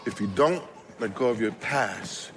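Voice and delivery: low, soft and deliberate; hushed, weighty sermon takeaway; solemn preaching point. Acted. Slow solemn closing point.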